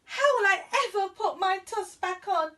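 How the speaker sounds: a high, loud wail of dismay. Acted. Ganesh wailing over his broken tusk, high and loud. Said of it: "Ganesh wailed".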